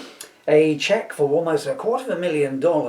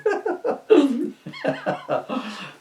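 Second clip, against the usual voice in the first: high surprised laugh; 13 semitones higher, 3 dB louder than usual. Real, not acted. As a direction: caught off guard, laughing high. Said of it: "took me by surprise as well".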